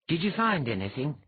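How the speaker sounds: a little excited. A narrator's reading. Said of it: "still sounded a little excited".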